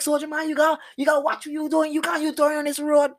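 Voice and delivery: high, put-on Creole argument voice; arguing back. Driver scolding the Rasta man about the road, high and put-on. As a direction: lecture him back in a mock voice. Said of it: "And I was like, I was like".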